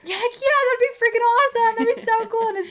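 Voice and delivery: imitating an excited boy, high and loud; thrilled kid impression. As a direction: gush like a kid offered a dream ride. Voices thrilled boy offered a ride.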